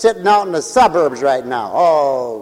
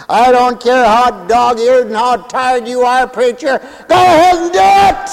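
raised, high voice building to a shout; rousing urging; 7 semitones higher, 5 dB louder than usual. Acted. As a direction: spur on a worn-out preacher. Voices urging a tired preacher.

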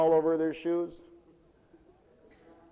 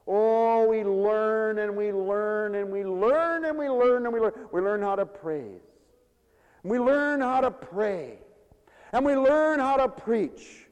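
slow, high preaching cadence, each line louder; preaching build; 7 semitones higher, 5 dB louder, slower than usual. Acted. Preacher to congregation, slow and building. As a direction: build the list slowly like a sermon.

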